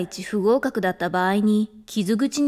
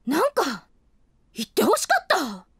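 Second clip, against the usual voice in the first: sulky; 3 semitones higher than usual. Acted. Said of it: "怒ってるんですか？".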